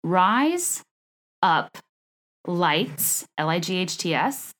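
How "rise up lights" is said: RISE, UP, LIGHTS each set apart with pauses; word by word. Shown as a demonstration. Host teaching an accent trick, Rise up lights word by word. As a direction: spell out Rise, up, lights one word at a time. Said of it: "you say".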